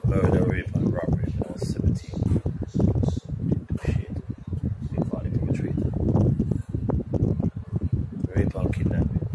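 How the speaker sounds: loud, heated shouting; angry rant. Real, not acted. Man ranting to camera about jail, shouting angrily. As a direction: yell it out in raw frustration.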